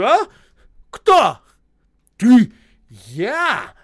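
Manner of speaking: high, loud indignant questioning; accusing shouts. A narrator's reading. Боев accusing Осип of pretending, shouting. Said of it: "закричал Боев Осипу".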